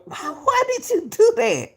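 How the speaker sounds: leaps very high in disbelief; incredulous. Real, not acted. Streamer questions Ceddy's apology, voice shooting high in disbelief.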